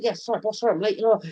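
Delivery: sheepish apology. Retold or imitated. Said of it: "I was like".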